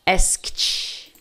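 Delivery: ASKED as one syllable ending in a sharp airy "kt". Shown as a demonstration. Teacher models the past tense for learners, ED as T. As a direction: end ASKED on a hissed T, no vowel. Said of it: "pronunciar o E D com som de T".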